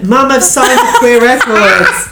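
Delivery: excited. Acted. Girl telling mum big news.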